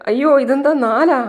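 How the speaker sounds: high, loud retold gasp of disbelief; stunned shock. Husband sees a fourth baby, high startled exclamation. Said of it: "ഞെട്ടലോടെ നിൽക്കുകയാണ്".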